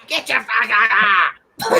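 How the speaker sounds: high mock yell of an angry Chicago grandma. Grandma yelling at dad Frankie, high mock yell. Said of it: "she's yelling".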